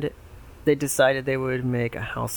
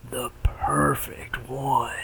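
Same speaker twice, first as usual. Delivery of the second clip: whispering; much slower than usual. Acted. Pig two whispers.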